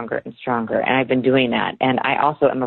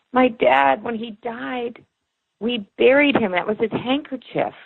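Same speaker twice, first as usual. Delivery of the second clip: moved client; 9 semitones higher, slower than usual. Retold or imitated. Client gasps, reveals father's handkerchief.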